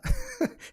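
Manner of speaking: high squealing laugh bursting out; burst of laughter. Real, not acted. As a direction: crack up at the punchline. Laughing at own funny story.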